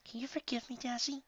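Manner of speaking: soft, high, gentle plea; tender pleading. Acted. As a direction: ask forgiveness very softly. Mother asks forgiveness.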